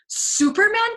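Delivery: very high, loud disbelieving yelp; incredulous cry. A narrator's reading. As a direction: shriek it in disbelief. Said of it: "Clevenger cried".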